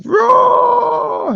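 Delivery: high, loud playful roar; mock monster roar. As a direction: roar like a cartoon beast.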